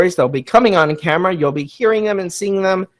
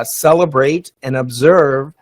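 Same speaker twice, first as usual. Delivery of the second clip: ordinary delivery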